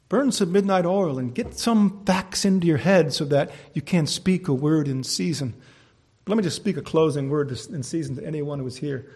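ordinary talk

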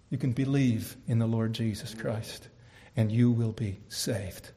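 solemn appeal. Real, not acted. Preacher offering salvation to the listener, low and solemn. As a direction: bring it down low and measured. Hushed appeal before the outburst.

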